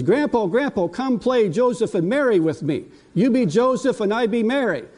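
a hint of an eager three-year-old asking grandpa to play; granddaughter's voice. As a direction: grandpa imitating his granddaughter's eager plea.

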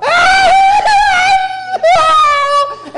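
loud, very high imitated child's wailing; piercing mock bawl of a little kid. Grandparent hears a four-year-old wailing from the hallway, mock bawling.

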